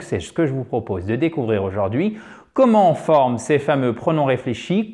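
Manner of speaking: ordinary delivery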